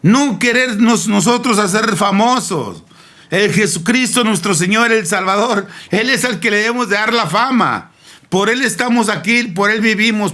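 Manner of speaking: high, driving pulpit cry; fervent preaching. Acted. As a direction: proclaim it to the whole church, pitch held high. Preaches at full force. (predicación ferviente)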